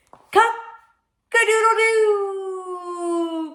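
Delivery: high, loud, long-held crowing; rooster crow. A narrator's reading. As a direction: crow it out and hold the last note. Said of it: "cried the rooster".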